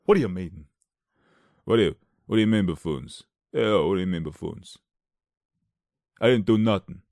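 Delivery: lightly voiced mock tough guy; lion as Stallone. Lion answering the Tin Man, mock tough-guy voice. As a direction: protest like a put-on movie lug.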